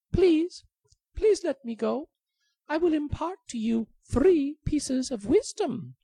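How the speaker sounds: high pleading bird voice; tiny high bird begging for its life. Acted. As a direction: squeak like a begging little bird.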